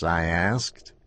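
ordinary delivery

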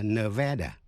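"Nevada" with middle a as in "cat"; local Nevada pronunciation. Shown as a demonstration. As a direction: say "Nevada" as nuh-VAD-uh. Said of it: "chứ không phải là Nevada". ("Nevada" đọc âm giữa như "ne-VE-đa")

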